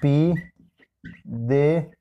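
PÍDESELO very slow, as pí, de, se, lo; syllable by syllable. Shown as a demonstration. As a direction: leave long gaps between the four syllables of "Pídeselo". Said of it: "Separamos en sílaba pídeselo". («Pídeselo» sílaba por sílaba: pí, de, se, lo)

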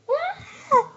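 high, loud cartoon yell with a laugh; mock scream. Kid voicing his toy launching himself, high laughing yell. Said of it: "He's like".

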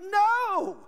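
loud, high refusal; emphatic shout. Real, not acted. Preacher rejecting that it is just humans, shouting. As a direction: cry it out in firm denial. Preacher answers own question forcefully.

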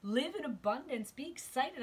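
a spirit urging joy; divine voice, excited. Acted. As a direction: deliver it as a joyful message from above. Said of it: "Divine is saying".

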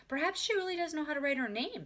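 high-pitched, gentle suggestion; kindly librarian. A narrator's reading. Miss Greaver defends Ramona to her sister, high and kindly. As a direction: suggest it indulgently.

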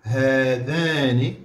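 هذان stretched slowly, rising; drawn-out rising هذان; slow answer. Shown as a demonstration. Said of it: "شنو نقولوا؟".

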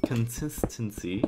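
"consistency" slowed right down, syllable by syllable, softly; saying it slow. Real, not acted. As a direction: sound out "consistency" slowly and quietly so it doesn't blur. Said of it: "I have to say it slow".

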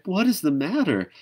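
high, loud frightened call; frightened cry. Acted. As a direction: cry out in fear. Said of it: "she cried".

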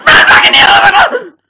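furious screaming outburst; angry scream. Real, not acted. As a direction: scream in rage at the game. Raging at the game.

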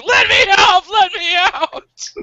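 high, loud, hammed-up cry to be let out; mock panicked scream. Wife trapped in a fart hug, mock panicked scream. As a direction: shriek like you're trapped, half laughing. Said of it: "And she's like".

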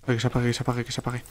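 soft repeated wish, fading away; nervous little prayer, very quiet by the end; muttered pleading. Real, not acted. Begging the fire to go out.

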